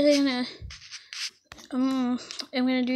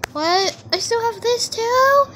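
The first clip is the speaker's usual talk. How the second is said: much higher, loud disbelief; surprised exclaim; 10 semitones higher, 7 dB louder than usual. Real, not acted. Artist shocked to find more left to colour. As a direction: blurt it out shocked.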